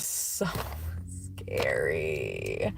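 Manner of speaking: low unvoiced whisper; hushed scary. Real, not acted.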